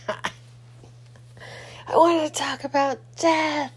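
slow, high ominous drawl; a hammed-up horror-movie creep; spooky voice. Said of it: "I'm just kidding".

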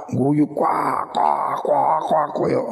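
cawing like a crow; mock crow cackle. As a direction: laugh in crow caws. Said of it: "jangan cerawak". (tawa menirukan gagak)

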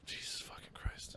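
breathless whisper; whispered shock. Real, not acted. Stunned by his friend's calorie count, whispered. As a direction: whisper it in disbelief.

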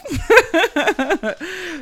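high burst of laughter. Real, not acted. Laughing at her own comeback, high and bright. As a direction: let out a high squealing laugh.

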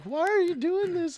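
very high mock of a pleading woman; mock woman's wail. Imitating the shoplifter pleading from the ground, high mock wail.

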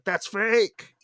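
loud, high cry of accusation; mock outcry. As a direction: call it out in hammed-up disbelief. Acting out brain rejecting real accent.

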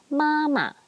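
"Mama" high and level. Shown as a demonstration. Teacher modelling first tone, "Mama" high and level. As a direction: keep both syllables of "Mama" up and even. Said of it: "first tone is high-level tone".

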